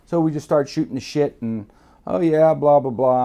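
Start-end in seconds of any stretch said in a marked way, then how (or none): 2.1-3.2 loud, airy filler chatter; mock-casual blather, louder than usual; pretended casual chat. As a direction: make forced small talk as if nothing's wrong. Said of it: "talking as casual as I can be".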